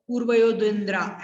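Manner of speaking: quick पूर्वयो द्विन्द्रा, endings dropped; hurried wrong recitation. Shown as a demonstration. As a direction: rush through पूर्वयो द्विन्द्रा with no long vowels. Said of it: "जल्दी जल्दी में नहीं बोलना". (पूर्वयो द्विन्द्रा जल्दी-जल्दी, विसर्ग छोड़कर)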